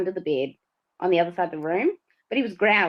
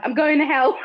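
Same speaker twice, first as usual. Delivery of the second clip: panicked cry; 6 semitones higher, 5 dB louder than usual. Retold or imitated. Feels pulled through the bed.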